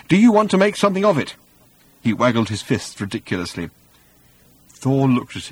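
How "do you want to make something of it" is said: high, fast, nervous challenge; quavering. A narrator's reading. Arthur standing up to a thunder god, quavering. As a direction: rush it out, voice climbing with nerves. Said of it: "could not keep the quavering out".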